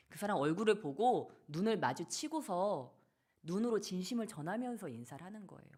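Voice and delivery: normal talking voice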